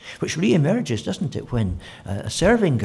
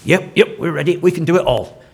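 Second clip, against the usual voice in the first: loud, higher, keen yep yep; over-eager volunteers jumping in; eager boast; 6 semitones higher, 10 dB louder than usual. Acted. James and John eagerly telling Jesus they are ready, loud.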